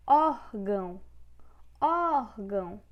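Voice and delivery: ÓRgão, ÓR loud and drawn out; stress on first syllable. Shown as a demonstration. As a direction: say órgão twice, each leaning hard on ÓR. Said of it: "penúltima sílaba".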